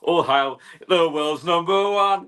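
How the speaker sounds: slow, high proclamation of the keeper; mock herald. Co-host hails the goalkeeper as a grand herald.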